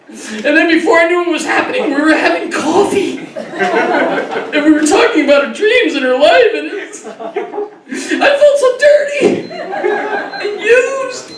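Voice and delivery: high, slow, hammed-up hurt whine; mock victim. Comic plays a man seduced over coffee, slow high mock-hurt whine. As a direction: stretch "used" out, wounded and high.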